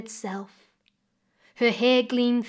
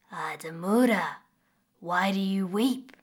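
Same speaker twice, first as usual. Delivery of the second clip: crocodile voice. Acted. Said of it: "came a familiar voice".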